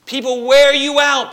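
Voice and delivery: high, loud burst of exasperation; emphatic outburst. Real, not acted. Pastor venting to congregation, loud exasperated burst. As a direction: hit it hard, fed up. Preacher emphasizing weary point.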